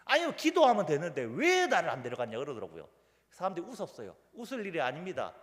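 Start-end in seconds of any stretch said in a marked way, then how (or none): 0.0-2.4 louder mock-indignant protest; retold fuss. Elderly deacon complaining about the missed outing, mock-indignant. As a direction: complain like a put-out old deacon, playfully. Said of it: "집사님이 난리가 난 거예요".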